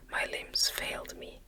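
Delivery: ordinary delivery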